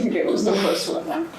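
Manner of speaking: amused, laughing repeat. Real, not acted.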